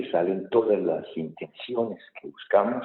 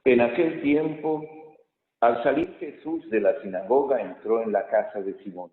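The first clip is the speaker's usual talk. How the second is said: raised, solemn proclamation; gospel reading; 4 semitones higher, 3 dB louder than usual. Real, not acted. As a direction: read it out to the whole church.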